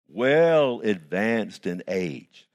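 drawn out word by word, slow. Acted. Preacher to the church, drawn out slowly. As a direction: stretch every word of it out.

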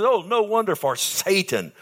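preacher shouting the verse; shouted exclamation. Shown as a demonstration. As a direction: proclaim it at full voice. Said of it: "He shouts it".

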